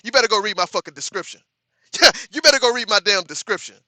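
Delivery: loud, laughing boast at chat; half-joking, bellow the warning; laughing loud threat. Real, not acted. Laughing threat to ban chatters.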